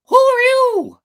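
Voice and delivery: very high, loud puppet call into the dark; high loud puppet voice asking who's there; startled puppet question. A narrator's reading. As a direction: call out in the dark like a scared child. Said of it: "asked Pinocchio".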